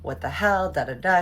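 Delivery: mock indignant complaint, trailing into filler; mad. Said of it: "She's mad".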